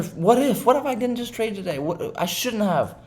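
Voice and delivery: high whining mock of his old self; mock regretful whine. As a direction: whine the what-ifs in regret. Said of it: "And then I'm sitting there".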